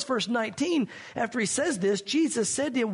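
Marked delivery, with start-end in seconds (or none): none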